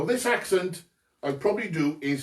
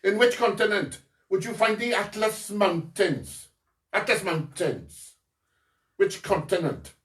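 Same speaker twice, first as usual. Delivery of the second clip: put-on North Wales accent; 3 semitones higher than usual. Quizmaster asking about Atlas Mountains, in a North Wales accent. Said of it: "That was my North Wales impression".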